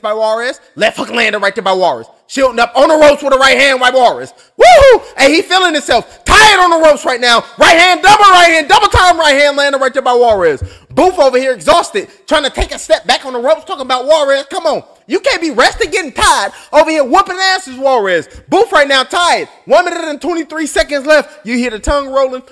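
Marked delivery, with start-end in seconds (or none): 4.6-5.2 high, loud whoop of delight; thrilled ringside whoop; excited whoop. Real, not acted. Excited commentary. 6.2-10.6 loud, higher, fast play-by-play; shouting the combination from ringside; hyped commentary. Real, not acted. 14.5-18.3 egging on. Real, not acted. Urging the fighter on.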